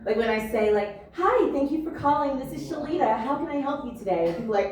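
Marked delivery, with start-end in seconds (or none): 1.2-4.3 higher, softer phone-agent greeting; polite call-center script that could pass for AI; customer service voice. Acted. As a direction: put on your customer service voice. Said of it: "customer service voice apparently sounds a lot like A I".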